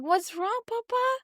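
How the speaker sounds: high, rising, coaxing question; concerned parent. Retold or imitated. Mother to her crying son, high concerned question. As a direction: ask like a parent checking on a little one.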